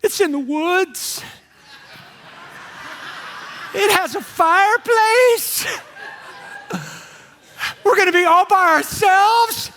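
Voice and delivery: high, slow, swooning voice with laughter; giddy vacationer wife. Retold or imitated. Wisconsin wife dreaming over the rental, giddy and high. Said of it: "They're like".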